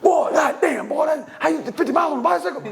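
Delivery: high-pitched, rapid mock astonishment; amazed dad. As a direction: exclaim like an incredulous father. Dad amazed at fifty-mile ride.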